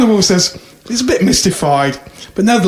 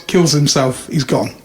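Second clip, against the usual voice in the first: usual speaking voice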